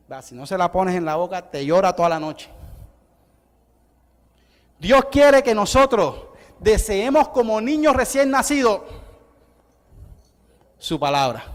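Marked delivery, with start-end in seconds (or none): 4.8-8.8 slow, emphatic preacher's line; preaching. Acted. Preacher urging the congregation, slow emphatic preaching. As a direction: lean on every word.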